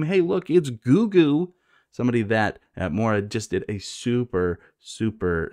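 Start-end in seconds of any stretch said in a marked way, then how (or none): 0.0-1.5 high, slow, drawn-out calling; mock excitement. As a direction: announce the sighting in a raised voice. Friends spot Goo Goo.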